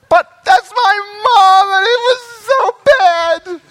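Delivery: put-on high, loud sobbing child voice; crying child. Preacher voicing a child crying to mommy, high and wailing. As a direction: wail it like a hurt kid who finally sees mom.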